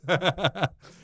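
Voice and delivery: short high laugh. Real, not acted. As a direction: laugh at the joke. Laughing at the mate Joe story.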